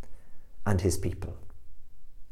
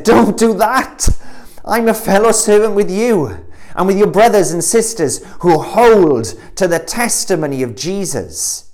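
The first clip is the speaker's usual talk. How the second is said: 1 urgent angel; 8 semitones higher, 4 dB louder than usual. Acted. Angel rebukes John.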